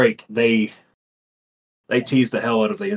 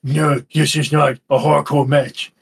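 slow, put-on complainer voice; mock bitching; 2 semitones higher, 3 dB louder, slower than usual. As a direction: draw it out like a griping fan. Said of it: "way too much bitching on Twitter".

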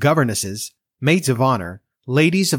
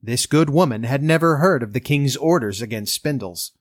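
ordinary delivery

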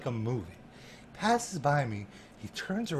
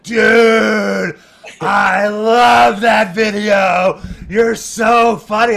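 higher and much louder, drawn-out shouting; retold excited yell; 6 semitones higher, 14 dB louder, much slower than usual. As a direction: belt it out like a thrilled fan across a backstage room. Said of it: "they heard, like, yelling".

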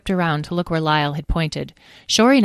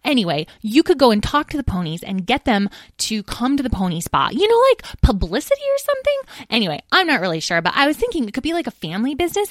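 lightly voiced, higher-pitched chatterer; chatty young dragon; 7 semitones higher, 3 dB louder than usual. Edwin pitching the pony spa to a sister, chatty. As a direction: pitch an idea in a rambling, lively voice.

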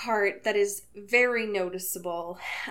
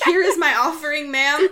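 mock offering; 6 semitones higher, 9 dB louder than usual. Acting out Edward presenting a gift.

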